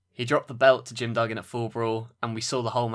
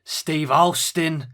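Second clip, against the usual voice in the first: Steve Austin impression; 5 semitones higher, 6 dB louder, much slower than usual. Shown as a demonstration. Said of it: "I can do".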